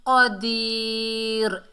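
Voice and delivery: क़दीर slowly with long i, closed r; stopping form. Shown as a demonstration. Teacher shows how to pause, drawn-out क़दीर. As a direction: stretch क़दीर and stop on r. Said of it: "ऐसे करके पढ़ना है".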